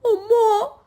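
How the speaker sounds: high, drawn-out wail; little crow's high dizzy moan; fainting cry.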